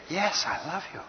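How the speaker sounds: softly and earnestly, voice lifted; mean every word; heartfelt, gentle I love you; sincere. Shown as a demonstration. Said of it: "then they believe the totality".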